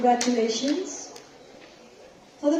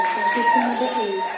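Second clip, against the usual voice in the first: pageant announcer; 6 semitones higher, 5 dB louder than usual. Acted. Announces first runner-up.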